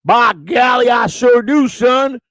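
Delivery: loud high put-on shout of a voice; Joey impression. Answering Joey by mimicking him, high and shouted. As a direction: bellow it out as the other guy. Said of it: "you sound like a wop version of me".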